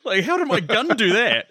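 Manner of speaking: high, bewildered what the fuck at his own gun; stunned disbelief. Retold or imitated. Bateman gawking at his exploding gun, baffled. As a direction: gape at the gun like it just did magic. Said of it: "looks at the gun like".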